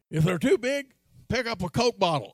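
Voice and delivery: gruff dad. Retold or imitated. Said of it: "dad's advice".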